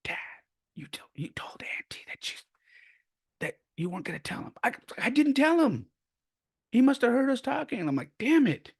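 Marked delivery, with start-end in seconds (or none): 0.0-4.5 imitating kids, hushed and halting; a small, mostly whispered accusation; hushed reproach. Kids' hushed betrayal, acted. 4.6-7.8 retold defensive squeal, very high; high-pitched protest. Acted defence to the kids. 8.2-8.7 retold high-pitched curse; exasperated outburst. Retold exasperation at being stuck.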